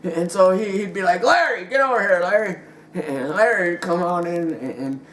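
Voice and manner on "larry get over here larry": calling out. Retold or imitated. Uncle calling Larry over.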